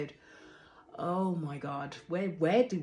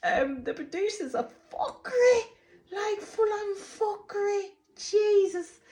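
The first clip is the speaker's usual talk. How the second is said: laughing rant; 11 semitones higher, 4 dB louder than usual. Real, not acted. Laughing rant about the producers.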